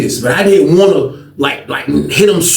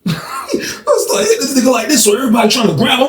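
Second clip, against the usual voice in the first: ordinary delivery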